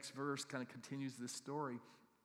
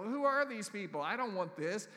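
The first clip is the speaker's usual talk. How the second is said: higher, louder mock protest; mock indignant protest; 6 semitones higher, 8 dB louder than usual. Pastor retelling his reaction to the gift, mock indignant. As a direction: protest the odd gift, hammed up. Retold own protest, loud.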